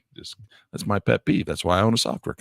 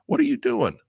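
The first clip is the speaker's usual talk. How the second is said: high, exasperated cry at the screen; real frustrated squeal at software; 13 semitones higher than usual. Real, not acted. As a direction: demand it from the stupid program. Yelling at the PDF software.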